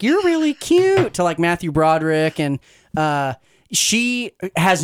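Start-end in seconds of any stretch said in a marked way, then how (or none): 0.0-1.1 high, drawn-out mock flirting; flirty redheaded scientist.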